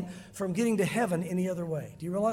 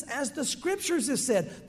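normal talking voice

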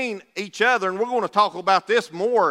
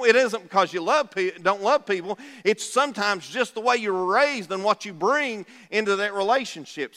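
ordinary talk, nothing marked

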